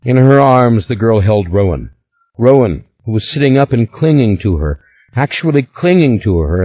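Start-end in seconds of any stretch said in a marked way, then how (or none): none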